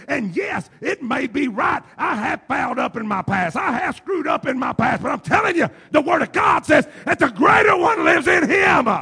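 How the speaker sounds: rising to a loud, high preaching cry; building preaching. Acted. Preacher shouting God's word to the congregation.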